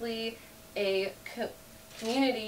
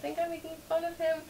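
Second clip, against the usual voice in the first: mock panic; 10 semitones higher than usual. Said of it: "I was like".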